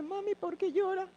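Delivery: put-on high, small voice of a little boy; lightly voiced toddler asking; child's voice. Voicing little son.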